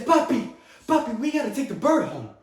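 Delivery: put-on high-pitched child's plea; pleading little son. Young son begging his father, high eager plea.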